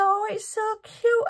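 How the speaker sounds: high, gushing delight. Retold or imitated. Recalling spotting the kit, high gushing delight. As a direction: squeal over something adorable. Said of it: "But I just thought".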